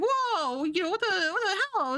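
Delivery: high, shocked retold cry; a driver staring at a coffin in the road, high and shocked; startled. Driver spots a coffin on the highway, high startled cry. Guy retells seeing a coffin.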